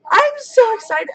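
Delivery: excited high-pitched squeal; real giddy squeak; really high voice. Real, not acted. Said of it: "my voice really high right now".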